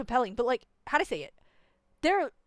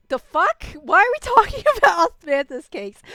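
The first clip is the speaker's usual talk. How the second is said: loud, high disbelief breaking into a laugh; incredulous outburst; 7 semitones higher, 9 dB louder than usual. Real, not acted. Player reacting to the game's cake talk, incredulous outburst.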